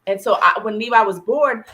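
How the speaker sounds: ordinary delivery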